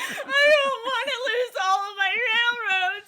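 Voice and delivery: put-on high, sobbing child's plea; crying little girl. Little girl begging her dad mid-game, high tearful plea. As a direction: beg through tears like a losing kid. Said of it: "she just starts crying".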